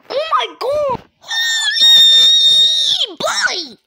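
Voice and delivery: very high, loud, long-drawn shriek; terrified screaming. Acted. Orion's victim under attack, screaming in terror. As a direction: scream the words out at full pitch.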